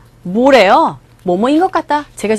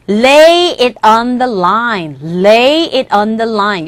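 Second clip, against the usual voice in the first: very slow, clear English model sentence; slow model reading; much slower than usual. Shown as a demonstration. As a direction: read it out slowly for the class to repeat. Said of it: "네 번째 표현 보세요". (천천히 또박또박 읽는 예문)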